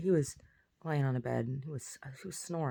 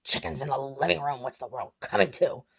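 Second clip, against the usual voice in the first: louder mock indignation for a dog; acting out dog's confusion; 6 dB louder than usual. Dog objects to a chicken in the living room, mock indignant. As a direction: voice a baffled dog, loudly protesting. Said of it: "he was all like".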